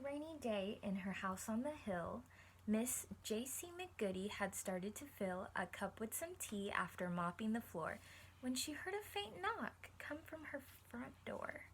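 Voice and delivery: ordinary delivery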